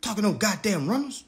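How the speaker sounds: ordinary talk, nothing marked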